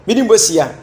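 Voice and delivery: high, loud burst of emphasis; exclaiming. Real, not acted. Vlogger stressing his point to viewers, loud high exclamation. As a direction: throw the words out with force.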